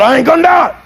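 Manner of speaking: high, hollered refusal; defiant shout. Retold or imitated. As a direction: holler it back as the stubborn driver. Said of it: "remarkably the same guy".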